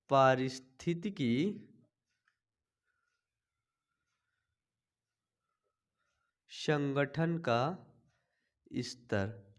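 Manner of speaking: each word drawn out very slowly and softly; slow dictation. Shown as a demonstration. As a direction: spell it out slowly so students can write it down. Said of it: "dictation repeated".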